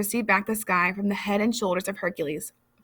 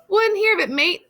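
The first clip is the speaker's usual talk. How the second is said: breezy bluff; 10 semitones higher, 5 dB louder than usual. A narrator's reading. Hercules brushing off offer.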